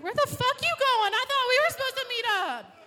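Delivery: high, loud indignant yell. Acted. Stood-up dater yelling at her date across the street, outraged. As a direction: call out after him, outraged.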